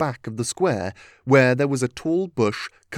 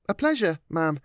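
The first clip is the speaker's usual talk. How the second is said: high, polite greeting; gracious young lady; 5 semitones higher than usual. A narrator's reading. Lillian greets new acquaintance, gracious. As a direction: lift the voice into a gracious hello. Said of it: "Lillian bowed graciously".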